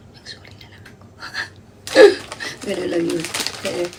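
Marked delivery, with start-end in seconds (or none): 0.1-1.1 whisper. Real, not acted. Confessing she just said sorry. 1.8-2.6 high giggle. Real, not acted. After sheepish confession.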